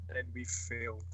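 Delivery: low, soft and glum; miserable. Retold or imitated. Captain Britain moping to Captain America, low and glum. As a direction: sigh it out like a defeated hero. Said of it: "Captain Britain's miserable".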